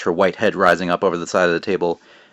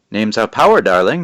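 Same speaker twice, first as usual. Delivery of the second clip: creepy witch voice; 2 semitones higher, 7 dB louder than usual. Acted. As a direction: purr it like a hungry old witch. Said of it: "Creepy voice, isn't it?".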